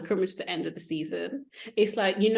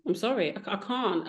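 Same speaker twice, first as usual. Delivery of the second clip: usual speaking voice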